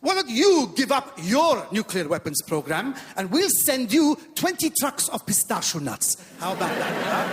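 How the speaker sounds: haggling in a put-on Iranian accent, slow and emphatic. Iranians bargaining with America, mock-haggling Iranian accent. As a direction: make a counter-offer in broad Iranian English. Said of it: "The Iranians say".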